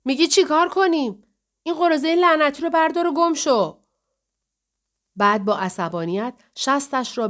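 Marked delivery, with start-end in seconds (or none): 0.0-3.8 loud, high-pitched angry yell; angry shout. A narrator's reading. Jane yells at the honking driver behind, angry shout. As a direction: shout it out in anger, high and forceful. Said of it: "جین فریاد زد".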